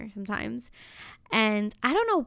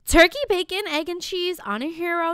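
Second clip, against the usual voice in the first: high, louder, fed-up order; exasperated repeat; 7 semitones higher, 4 dB louder than usual. Retold or imitated. Customer repeating her order to the deli guy, high and exasperated. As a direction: repeat the order higher, losing patience.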